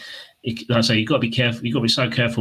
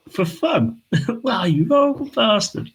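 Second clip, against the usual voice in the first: high, incredulous imitation of a protester; hammed-up indignation with a laugh; mock outrage; 11 semitones higher, slower than usual. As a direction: play the appalled protester, pitched up. Replays protester's shocked reply, laughing.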